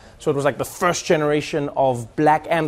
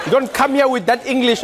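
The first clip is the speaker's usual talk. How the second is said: high, loud, mock-furious African-accented dad; angry dad; 7 semitones higher, 4 dB louder than usual. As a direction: scold the smart-mouthed kid like an outraged father. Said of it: "Be like".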